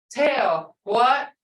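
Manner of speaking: ordinary talk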